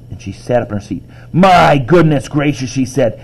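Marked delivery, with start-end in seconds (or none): 1.3-2.7 slow, higher, louder exclamation; jaw-dropped astonishment. A narrator's reading. Imogen shocked at no room in the inn, astonished. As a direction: stretch it out in loud amazement. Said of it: "Imogen's jaw dropped".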